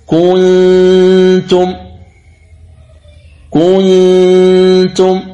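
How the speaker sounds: كنتم with the ن hidden, drawn out through the nose; nasal ghunna on kuntum. Shown as a demonstration. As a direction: hum the ن of كنتم without touching the tongue. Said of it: "فتقول".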